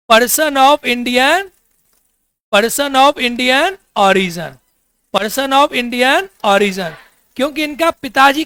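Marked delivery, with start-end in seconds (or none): 0.1-6.9 very slow, each word laid out apart; slow dictation. Shown as a demonstration. Teacher dictating person of Indian origin to the class, very slowly. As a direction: say it so students can write along.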